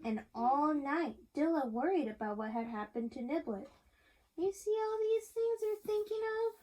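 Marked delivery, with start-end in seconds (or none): 4.4-6.5 higher, teasing storyteller question; playful questioning. Acted. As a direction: tease the child with a higher voice. Reader voices the story's question.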